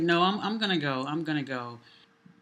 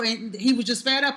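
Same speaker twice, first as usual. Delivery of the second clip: ordinary talk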